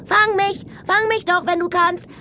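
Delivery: put-on very high, gleeful girlish taunt; übermütig. A narrator's reading. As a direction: call back teasingly while racing off. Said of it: "rief Mea übermütig". (übermütig gerufen, hohe Mädchenstimme)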